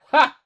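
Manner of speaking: one loud, harsh bark of a laugh; single barked laugh. Acted. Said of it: "barked out a single laugh".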